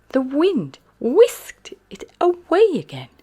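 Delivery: ordinary delivery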